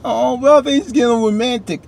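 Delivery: high playful teasing voice; teasing coo. Real, not acted. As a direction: tease the viewers about the romance. Teasing about the romance.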